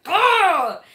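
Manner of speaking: loud high exasperated groan; frustrated ugh. Retold or imitated. Stomping at husband's bowl, loud frustrated ugh. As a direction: let out an angry ugh.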